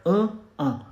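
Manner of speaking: normal talking voice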